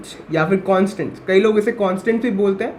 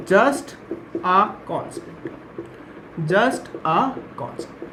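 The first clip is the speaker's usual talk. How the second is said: spaced-out words, trailing softer; slow dictation; 4 dB quieter, much slower than usual. Real, not acted. Teacher dictating a simple definition to students, slow dictation. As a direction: dictate in small pieces with pauses.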